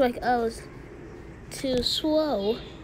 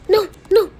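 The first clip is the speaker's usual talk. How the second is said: loud excited panic; 7 semitones higher, 5 dB louder than usual. Real, not acted. Panic in game.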